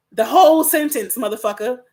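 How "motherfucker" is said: ordinary talk, nothing marked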